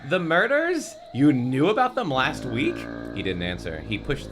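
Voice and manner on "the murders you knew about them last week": high-pitched, incredulous questioning; hammed-up alarmed question; shocked disbelief. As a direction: ask it aghast, voice shooting up. Narrator voices Rachel's shocked question.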